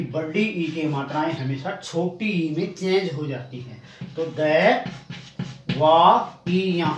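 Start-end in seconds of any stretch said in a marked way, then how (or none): 4.4-6.9 द वा ई या slowly, one sound at a time; teacher's measured breakdown of द वा ई या; syllable-by-syllable demo. Shown as a demonstration. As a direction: separate each piece of द वा ई या. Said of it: "इसका सही उच्चारण ये होगा".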